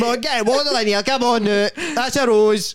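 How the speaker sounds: high, quick comic urging; mock live-stream seller. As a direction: hustle viewers in a high put-on voice.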